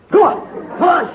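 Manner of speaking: usual speaking voice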